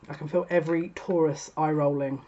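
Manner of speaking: ordinary delivery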